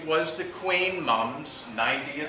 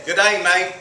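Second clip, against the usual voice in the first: "Good day, mate" in a loud, higher Australian accent; 4 semitones higher, 6 dB louder than usual. Shown as a demonstration. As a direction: greet them like an Australian. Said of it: "Another easy one is Australian".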